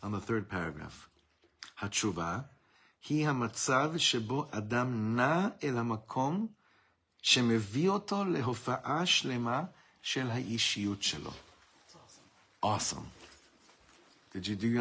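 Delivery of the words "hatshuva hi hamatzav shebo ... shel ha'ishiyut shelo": slow, word by word; slowly in Hebrew. Shown as a demonstration. Teacher rereading a line to students, slowly. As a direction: let each Hebrew word land for the class. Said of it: "I'm gonna say it again in Hebrew slowly".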